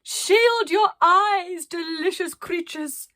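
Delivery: high, loud, slow theatrical yell; yelled over the wind. Acted. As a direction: bellow into the storm grandly. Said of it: "she yelled".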